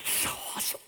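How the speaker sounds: hushed, amazed whisper; awed whisper. Pretend. Boy Samuel inside the holy place, awed whisper. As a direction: breathe it out in wonder.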